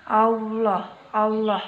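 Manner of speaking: "Allah" with a heavy, full-mouthed l and a dark o-like vowel; thick lam in Allah. Shown as a demonstration. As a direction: say "Allah" as aulloh, tongue pressed up so the lam sounds thick. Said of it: "huruf lam harus terdengar suaranya menebal".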